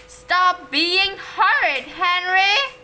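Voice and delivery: loud, slow scolding shout. A narrator's reading. Mother shouting at her quarrelling son. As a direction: bellow it down at the boys. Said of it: "shouted Mom".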